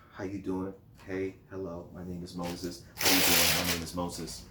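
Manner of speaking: low, soft, humble greeting; meek Moses. Pretend. Humble Moses greeting his people, soft and meek. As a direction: introduce yourself gently and modestly. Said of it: "He was".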